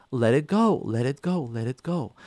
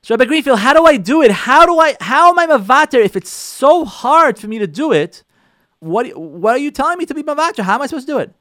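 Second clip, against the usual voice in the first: louder, higher pleading listener asking the rabbi; questioning congregant; 6 semitones higher, 8 dB louder than usual. Retold or imitated. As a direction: beg the rabbi for a way, raised. Voices a congregant asking him.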